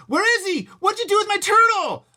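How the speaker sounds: very high, loud furious yell. Acted. Big brother yelling at Fudge about turtle, furious. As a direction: scream the question at him. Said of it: "I yelled".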